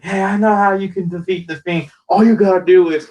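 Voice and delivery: high, eager puppet voice; Rambling Rabbit. Retold or imitated. Rabbit puppet offering advice on The Fiend, high-voiced. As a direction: pitch it up like a helpful cartoon rabbit. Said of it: "Rambling Rabbit came on the screen".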